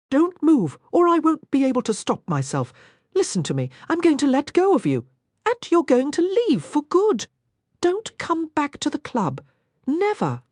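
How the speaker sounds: man fighting desire ordering woman away; strained commands. Acted. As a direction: hold her off with raw urgency. Man losing control, ordering her away.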